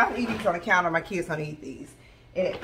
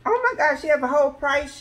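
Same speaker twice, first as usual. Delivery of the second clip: excited squeal; 8 semitones higher, 5 dB louder than usual. Real, not acted. Excited over price sheet.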